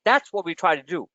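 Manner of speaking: normal talking voice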